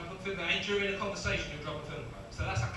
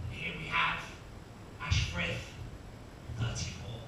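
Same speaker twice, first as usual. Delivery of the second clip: slow, measured commentary with dramatic gaps; sports commentator; 3 semitones lower, slower than usual. Acted. Commentator introducing the stair racer as he warms up, measured commentary. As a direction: introduce the athlete slowly, pausing between phrases. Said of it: "the first commentator will kick in".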